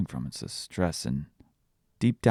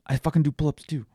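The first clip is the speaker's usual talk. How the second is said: put-on higher, louder cute kid voice; funny toddler voice; 9 semitones higher, 6 dB louder than usual. Dad imitating daughter's funny way of swearing, high and loud. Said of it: "said it in a funny way".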